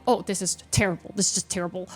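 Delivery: soft, quick moan of doom; quiet dread. Retold or imitated. Player dreading a streamed match, softly despairing. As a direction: mutter it like it's hopeless.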